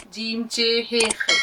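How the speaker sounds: slow recital of the letter names; slow, even letter recitation; reciting letters. Shown as a demonstration. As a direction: recite the alphabet slowly like a drill. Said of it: "सीखा भी आप लोगों ने ना".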